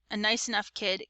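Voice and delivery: usual speaking voice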